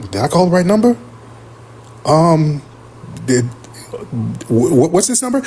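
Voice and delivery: ordinary talk, nothing marked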